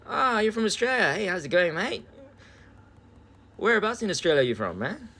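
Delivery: high, excited hello to a compatriot; delighted greeting. Real, not acted. Streamer greeting an Australian viewer, high and delighted. Spots a fellow Australian in chat.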